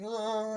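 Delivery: high mock scream; imitated Toad scream. Toad startled by the ape, high mock scream. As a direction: squeal in pretend fright. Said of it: "and then Toad's like".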